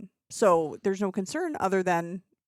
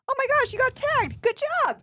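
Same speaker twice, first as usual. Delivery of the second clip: much higher, cheery congratulation; mock-excited praise. Handler cheering a bitten kid, high mock excitement. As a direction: make the bitten kid feel proud. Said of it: "he goes".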